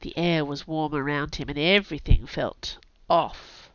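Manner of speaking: plain reading voice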